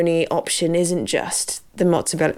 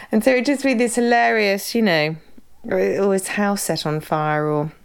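ordinary delivery

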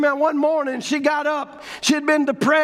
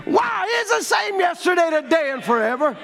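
high-pitched preacher's cry; exultant preaching; 5 semitones higher than usual. Real, not acted. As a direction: proclaim it high to the congregation. Preacher exults after the miracle.

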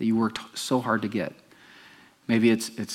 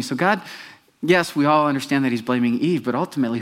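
ordinary delivery

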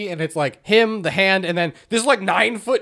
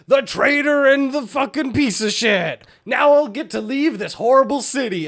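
hammed-up game boss in a raised pitch; mock villain; 8 semitones higher than usual. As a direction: declaim it like a cheesy final boss. Mocking the boss's villain voice lines.

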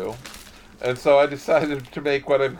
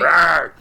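playful little roar, louder; shark roar; 4 semitones higher, 7 dB louder than usual. Pretend. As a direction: growl it like a cartoon shark. Playful shark roar after the can.

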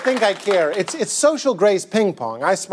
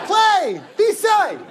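high, hollered commands; exasperated urging; 10 semitones higher than usual. Acted. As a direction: burst out, urging them to lighten up. Comic command after stiff interview.

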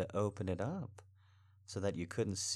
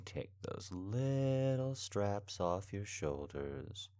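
ordinary talk

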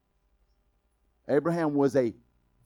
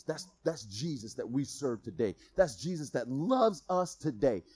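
ordinary delivery

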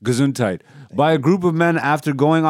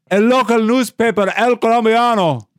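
mock Spanish; 8 semitones higher, 5 dB louder than usual. Mock Spanish newspaper name.

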